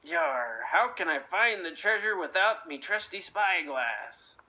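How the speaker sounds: low pirate grumble, puzzled; deep-voiced puzzled buccaneer; gruff pirate voice. Blackbeard wonders aloud.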